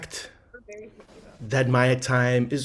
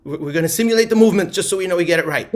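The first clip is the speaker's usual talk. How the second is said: rushed; 3 semitones higher, 6 dB louder, much faster than usual. Real, not acted. Hurried instruction to crowd.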